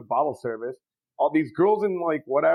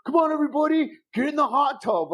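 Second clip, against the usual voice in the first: mock party host; 10 semitones higher than usual. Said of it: "every text message to me was like".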